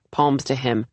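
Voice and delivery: ordinary delivery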